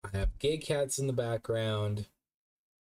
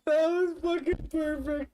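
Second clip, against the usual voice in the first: laughing. Real, not acted. Streamer cracking up at clip.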